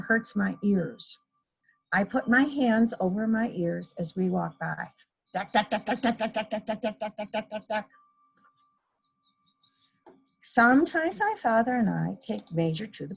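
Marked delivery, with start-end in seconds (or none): 5.3-7.9 fading jackhammer. A narrator's reading. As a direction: let the jackhammer fade behind you. Jackhammer noise fading as they pass.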